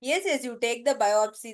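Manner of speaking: ordinary talk, nothing marked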